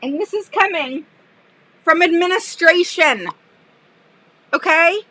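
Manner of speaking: usual speaking voice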